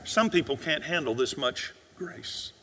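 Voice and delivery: sad, quiet truth. Real, not acted. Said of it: "Let me tell you the sad truth".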